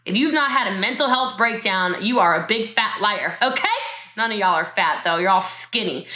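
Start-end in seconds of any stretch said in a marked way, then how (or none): none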